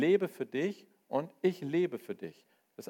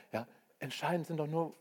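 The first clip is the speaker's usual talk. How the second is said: ordinary delivery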